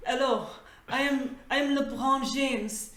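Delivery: hammed-up French chef voice; card speaking as a pompous French chef; mock French accent. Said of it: "the card saying".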